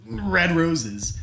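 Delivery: high, loud blurt. Acted. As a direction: blurt it out awkwardly in a loud rush of nerves. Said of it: "So he just blurts out".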